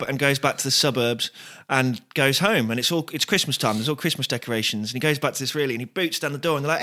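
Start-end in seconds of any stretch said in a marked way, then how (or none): none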